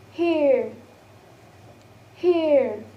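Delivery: "hear" drawn out with falling pitch; example word demo. Shown as a demonstration. Teacher giving an example word for ear, drawn-out pronunciation demo. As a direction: model "hear", gliding through the vowel. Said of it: "as in the word of".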